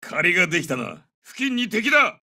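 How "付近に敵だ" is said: high, urgent combat call; enemy alert. Acted. Soldier warns squad enemy is near, urgent high callout. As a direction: warn of danger close by.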